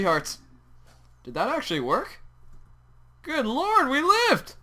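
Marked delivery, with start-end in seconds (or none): 3.2-4.5 relieved exclamation. Real, not acted. Survived the attack.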